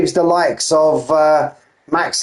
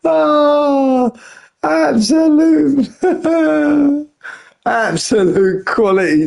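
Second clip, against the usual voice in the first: high, drawn-out, laughing words; real helpless amusement; laughing delight; 14 semitones higher, slower than usual. Real, not acted. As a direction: squeal it through laughter. Laughing at something funny.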